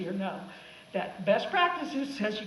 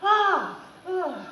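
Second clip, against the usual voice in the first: mock fright; 6 semitones higher, 4 dB louder than usual. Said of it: "Santa freaks out".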